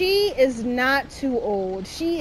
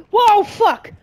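gasped, high, loud swear; startled gasp; 8 semitones higher, 9 dB louder than usual. Real, not acted. Player startled by the old lady, gasping a loud curse. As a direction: blurt it out in shock.

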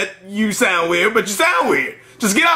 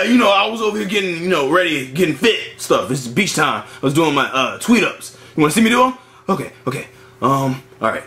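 ordinary talk, nothing marked

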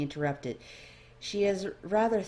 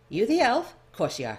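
sudden accosting voice; 5 semitones higher, 6 dB louder than usual. Acted. Someone accosts the elf.

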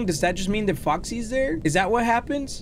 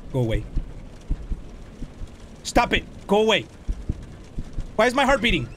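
ordinary talk, nothing marked

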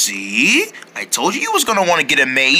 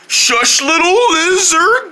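ordinary talk, nothing marked

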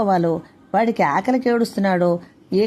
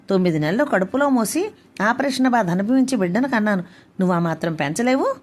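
ordinary delivery

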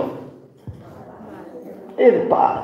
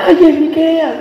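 much higher, louder cry with a laugh; relieved mom spots her son; wife recognizes her lost boy, laughing cry; excited recognition; 10 semitones higher, 4 dB louder, slower than usual. Retold or imitated.

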